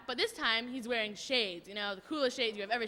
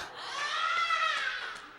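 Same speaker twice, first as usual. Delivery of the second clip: high laughter; 13 semitones higher than usual. Real, not acted. Listener laughing at the string joke's punchline.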